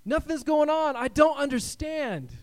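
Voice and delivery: high, loud exasperated complaint; frustrated believer. Retold or imitated. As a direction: whine at God that nothing happens. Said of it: "We're going".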